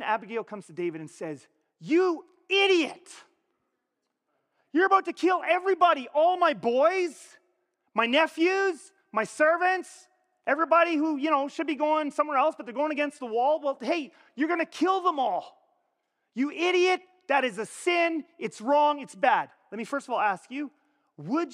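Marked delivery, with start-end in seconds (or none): 1.8-11.2 high, loud, indignant tirade; furious scolding at shouting level; angry confrontation. Acted. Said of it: "Imagine if she comes to him like this". 11.2-19.5 loud, high-pitched scolding; indignant, raised-voice telling-off; angry confrontation. Acted. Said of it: "Imagine if she comes to him like this".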